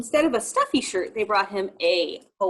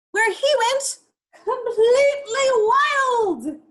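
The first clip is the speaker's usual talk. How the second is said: much higher and louder, slow exulting; excited; 14 semitones higher, 8 dB louder, slower than usual. Acted. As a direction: sing out the freedom of the wild. Narrator exults, tiger free.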